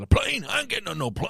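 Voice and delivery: high-pitched protesting impression; hammed-up refusal to board; B A Baracus. Podcaster imitating the TV character refusing to fly, hammed-up protest.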